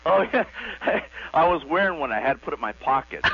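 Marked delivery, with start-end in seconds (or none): 0.1-1.3 laughing. Real, not acted. Caller laughs at Rolex joke.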